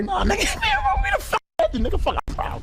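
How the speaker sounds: high hype yelling through laughter; laughing shout. Real, not acted. Hypes himself, laughing shout.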